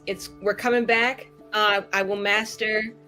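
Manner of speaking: usual speaking voice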